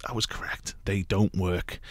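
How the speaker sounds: whispering, disappointed; whispered aside. Real, not acted. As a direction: drop to a whisper.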